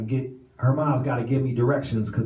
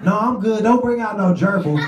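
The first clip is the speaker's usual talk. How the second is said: panicked refusal; 8 semitones higher, 5 dB louder than usual. Retold or imitated. Jinx refusing gerbil, panicked.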